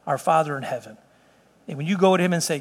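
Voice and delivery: usual speaking voice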